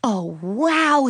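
a touch of fan amazement; excited exclamation. A narrator's reading. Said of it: "Suzanne exclaimed".

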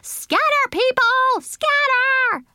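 very high panicked yell; shrieked high alarm. A narrator's reading. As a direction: yell a warning to everyone. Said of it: "I yelled".

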